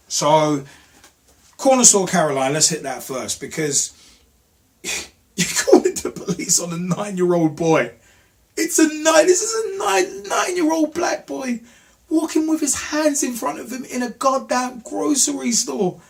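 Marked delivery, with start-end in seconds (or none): 4.8-16.0 high, squeaky, exasperated protest; incredulous outrage. Real, not acted. Ranting to viewers about a nine-year-old boy, incredulous outrage. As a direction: voice shooting up very high in disbelief.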